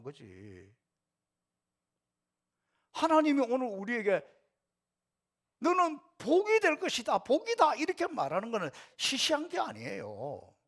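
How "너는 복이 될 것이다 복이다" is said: proclaimed high and loud; God's blessing. Acted. Preacher voicing God's promise to the congregation, high and loud. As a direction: announce the blessing with ringing certainty. God's declaration quoted in sermon.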